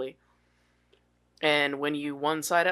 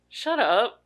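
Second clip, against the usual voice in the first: higher-pitched, dismissive mock "shut up"; retold comeback; 7 semitones higher than usual. Imitating a comedian answering booers, dismissive. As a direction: toss it off at imagined booers. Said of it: "he'd be like".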